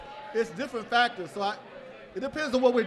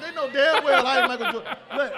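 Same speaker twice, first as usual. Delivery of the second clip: normal talking voice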